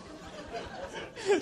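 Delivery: high breathy laugh. Real, not acted. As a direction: laugh at the answer. Laughing at responses.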